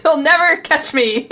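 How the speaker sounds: gleeful, laughing and loud. Real, not acted. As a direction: giggle through the words. Laughing while escaping.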